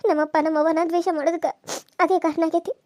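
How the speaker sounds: ordinary talk, nothing marked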